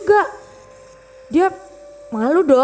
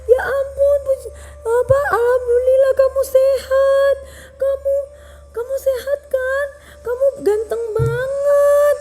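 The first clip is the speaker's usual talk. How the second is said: tearful joy; 12 semitones higher than usual. Acted. Mother weeping as she hugs her long-lost son.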